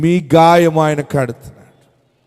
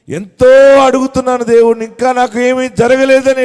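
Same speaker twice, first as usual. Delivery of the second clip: loud, high plea voiced for a believer; acted tearful complaint; 5 semitones higher, 8 dB louder than usual. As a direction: cry out as a worn-out petitioner, without real sobs. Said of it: "ఏడుస్తున్నావేమో".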